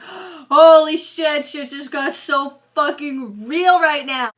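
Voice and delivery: loud, slow, stunned exclamation; shocked outburst. Retold or imitated. Frank sees his sidekick dead, loud, slow stunned shock. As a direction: drag out the disbelief, loud and slow. Frank reacts to Bolty's death.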